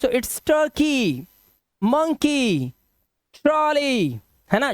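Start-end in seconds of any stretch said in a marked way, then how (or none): 0.5-1.2 turkey with a long "kee" ending; slow teacher's model of turkey, ending in "ee". Shown as a demonstration. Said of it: "ईवाई का उच्चारण ई से". 1.8-4.2 monkey, trolley with long "ee" endings; teacher's model, monkey and trolley ending in a held "ee". Shown as a demonstration. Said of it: "ईवाई का उच्चारण ई से".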